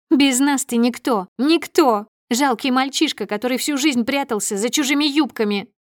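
high, loud contemptuous wife; scornful tirade. A narrator's reading. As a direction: belittle your husband furiously. Wife berates husband, waving her arms. (презрительно и гневно, на повышенных тонах)

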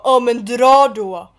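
loud, drawn-out brush-off; mock dismissive jeer. Retelling how a guy told them to leave, mock dismissive. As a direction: imitate a cocky guy sending someone away.

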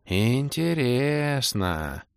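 very slow, stretched-out musing; Орбит, thoughtful drawl. A narrator's reading. Elf muses at the demoness, drawling thoughtfully. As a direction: draw every vowel out, high and pondering. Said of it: "задумчиво протянул Орбит".

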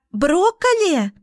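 high shrieking squeal. A narrator's reading. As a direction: squeal it in childish disgust. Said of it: "взвизгнула девчонка".

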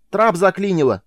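high loud yell; yelling back. A narrator's reading. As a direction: shout over the engine roar. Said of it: "заорал в ответ Майлз".

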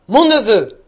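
loud, severe address; stern. A narrator's reading. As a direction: answer your nephew sternly, with force. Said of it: "reprit l'oncle sévèrement". (ton sévère et appuyé)

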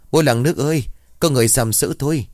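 mild high-pitched alarm; cry for help. A narrator's reading. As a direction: call for help in a high, understated storyteller's voice. Woman cries out for help.

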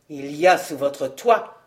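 low, restrained anger; contained anger. A narrator's reading. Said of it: "d'une voix contenue et que la colère faisait trembler".

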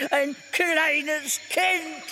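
slow shout. Acted. Cornelius ends his rant.

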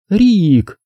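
high-pitched, mildly commanding interruption; imperious woman. A narrator's reading. Zelda interrupts the men's racing talk, mildly imperious. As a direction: cut in with a touch of authority, pitched high. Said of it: "властно вмешалась Зельда".

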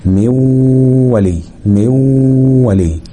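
slow مو ولي, long nasal w in between; waw with ghunna. Shown as a demonstration. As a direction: hold the hum on مو before ولي. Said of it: "والغنة بتاعتهم".

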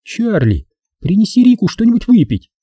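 high female voice giving an order; imperious woman, pitch leaping up; dog-command tone. A narrator's reading. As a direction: order him about like a dog. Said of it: "таким тоном, каким отдают приказания собаке".

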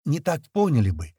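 ordinary delivery, nothing marked

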